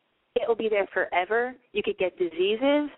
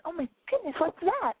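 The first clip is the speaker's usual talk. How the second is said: put-on high, wondering little-girl voice; 8 semitones higher than usual. A narrator's reading. Narrator voices the little girl spotting something, high. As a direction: gasp it like a small curious girl. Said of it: "The little girl goes".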